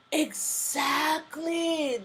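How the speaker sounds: very slow, stretched "Exactly"; drawn-out agreement. Real, not acted. Agreeing with Overseer's comment, stretched slow and high. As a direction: savour the word, stretch it out.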